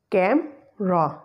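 slow "camera" in two parts, CAM, ra; split syllables. Shown as a demonstration. Teacher showing the hack on "camera", slowly split as CAM-ra. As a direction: break "camera" after CAM, middle vowel dropped. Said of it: "we will pronounce it as camera".

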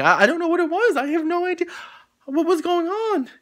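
high, loud, exasperated squeal; high-pitched baffled outcry; squealing disbelief. Real, not acted. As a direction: shriek your confusion. Exasperated confusion at the clinic.

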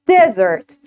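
Desert with stress on the first syllable, falling. Shown as a demonstration. Teacher models Desert for the class, stress first. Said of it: "Repeat after me".

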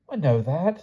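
high, sad little admission. A narrator's reading. King admits his mistake to Palomides, sadly. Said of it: "said the king sadly".

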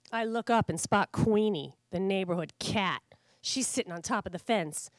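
ordinary delivery, nothing marked

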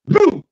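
very high sharp boom; high sharp mock boom; strike sound. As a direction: snap it out like a strike. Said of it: "Cobra goes".

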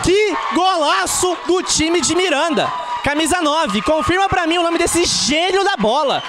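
high, drawn-out roaring goal call; explosive goal commentary. Real, not acted. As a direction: stretch every word in triumph. (grito de gol prolongado)

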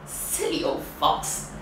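usual speaking voice

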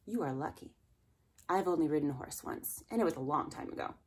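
ordinary delivery

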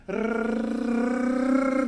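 R trilled with tongue pushed forward; buzzing trilled Ř; Czech Ř sound. Shown as a demonstration. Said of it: "Start with R, then move your tongue forward".

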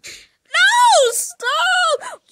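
very high, loud crying shriek; shrieking protest. Acted. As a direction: shriek no and beg him to stop. Girl protesting the dean's snapping.

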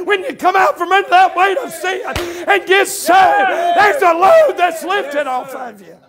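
high, driving, shouted sermon delivery; full-force preaching. Real, not acted. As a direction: preach it hard, punching phrase after phrase.

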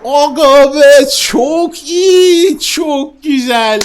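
high, loud, slow shouts of joy; excited shout. Real, not acted. Gamer yells over the hatched emperor dragon, ecstatic shout. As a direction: yell it out in delight.

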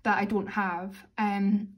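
ordinary talk, nothing marked

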